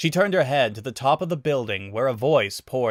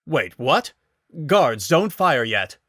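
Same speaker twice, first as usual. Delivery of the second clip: louder, caught-off-guard order; startled; 4 semitones higher, 5 dB louder, slower than usual. Acted. Guard halting his men in surprise.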